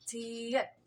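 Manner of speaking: छियत said slowly, ch then i-yat; careful teacher pronunciation of छियत; letter name demo. Shown as a demonstration. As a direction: model the name छियत for students. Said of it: "इसको लिखना कैसे है?".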